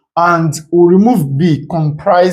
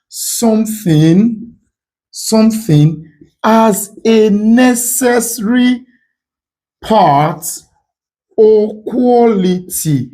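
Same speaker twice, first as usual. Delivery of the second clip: very slow and higher, phrase by phrase; raised pitch, drawn-out teacherly dictation; slow, deliberate definition; 6 semitones higher, slower than usual. Shown as a demonstration. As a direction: spell it out slowly with long pauses. Spells out a definition slowly.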